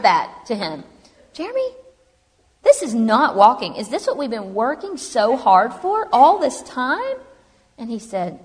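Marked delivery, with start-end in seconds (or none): 1.3-7.2 high-pitched, emphatic disbelief, slowing as it goes; exasperated protest. Retold or imitated. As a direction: replay a dismayed complaint to the therapist. Retold protest to her therapist.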